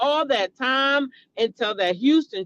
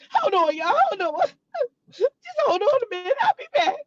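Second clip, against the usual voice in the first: high, fast, hammed-up sobbing voice; mock fake crying; 9 semitones higher, faster than usual. Vlogger parodies a streamer's fake tears before leaving camera, mock sobbing. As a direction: fake a teary break as a parody.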